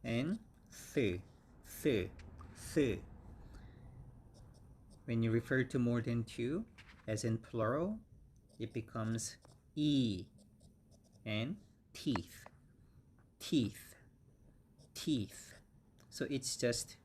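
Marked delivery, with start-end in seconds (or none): 0.9-3.0 repeated th with tongue between teeth; th sound drill. Shown as a demonstration. Teacher demonstrates the th sound for students. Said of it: "the same th sound". 11.2-15.5 teeth with a long E and a clear th; teeth drill. Shown as a demonstration. Teacher models the plural teeth for students. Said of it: "long E".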